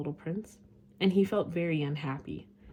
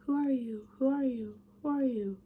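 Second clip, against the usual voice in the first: repeated higher, fading echo; 6 semitones higher, 4 dB louder than usual. A narrator's reading. The mountain echoing the prince's question back. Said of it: "the echo answered".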